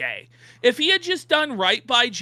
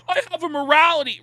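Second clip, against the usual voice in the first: higher, louder, fast mock self-righteous voice; mocking Rich; 8 semitones higher, 6 dB louder, much faster than usual. Mocking Rich's claim to have morals, a higher, louder mock voice. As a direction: sneer it out as a smug fake moralist. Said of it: "Rich being like".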